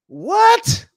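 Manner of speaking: very high, loud yelp of a question; outraged disbelief. Real, not acted. Fan reacting to swapping Fields for a rookie, outraged. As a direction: explode upward in sheer disbelief. Outraged reply to imagined trade.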